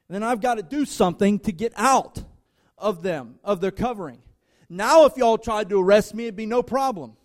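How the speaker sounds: loud, driving preacher cadence; pounding pulpit delivery; full force preaching. Acted. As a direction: build the sermon to a loud peak.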